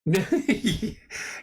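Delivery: short high laugh. Real, not acted. Streamer amused by late-night confession, high laugh. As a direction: burst into a high little laugh.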